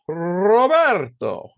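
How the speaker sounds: theatrical mock-grand hail; Leslie Nielsen. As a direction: roll the R and stretch the name. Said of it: "I'll never forget it".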